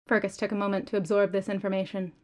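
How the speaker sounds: ordinary delivery, nothing marked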